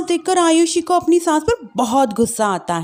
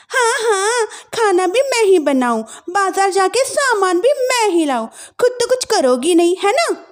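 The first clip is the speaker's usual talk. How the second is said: higher, mocking complaint; sarcastic young wife; 8 semitones higher than usual. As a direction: taunt the lazy sister-in-law. Wife taunts.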